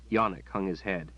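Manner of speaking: ordinary delivery, nothing marked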